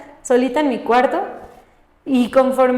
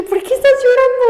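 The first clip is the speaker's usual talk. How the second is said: bewildered self-question; 12 semitones higher, 3 dB louder, faster than usual. Retold or imitated. Retells asking herself while crying.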